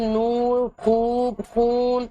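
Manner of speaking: نور، خوب، خون with the و held long as "oo"; long clear vowel. Shown as a demonstration. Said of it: "واو خوب صاف ادا ہو رہا ہے". (نور، خوب، خون کو کھینچ کر)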